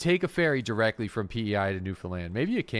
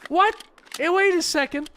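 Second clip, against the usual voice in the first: very high, loud incredulous questioning; startled disbelief; 5 dB louder than usual. Real, not acted. Gamer spotting a scab with looted helmet, startled disbelief. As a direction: react in real, loud surprise.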